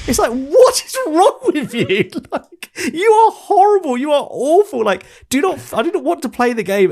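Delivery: half-laughing, half-horrified rant at a vile character; outraged disbelief. Real, not acted. Outraged by Rusty's comment.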